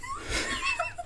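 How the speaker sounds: burst of laughter. Real, not acted. Laughing after the intro.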